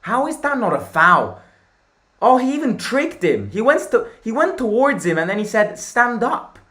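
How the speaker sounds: loud, high outraged protest; indignant shouting. Real, not acted. Fan protesting a foul not given, indignant shout. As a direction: yell at the referee in disbelief.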